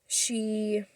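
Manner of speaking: usual speaking voice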